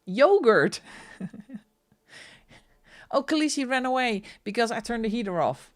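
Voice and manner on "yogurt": Yogurt said YOH-gurt with a hard English g. Shown as a demonstration. Showing viewers the English word. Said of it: "let's throw in some difficult words".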